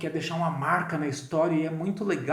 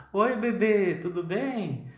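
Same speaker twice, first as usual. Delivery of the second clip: higher cooing greeting; baby talk; 6 semitones higher, slower than usual. Retold or imitated. Adult cooing hello to a baby. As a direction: coo sweetly at the baby. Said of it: "Não fala, né".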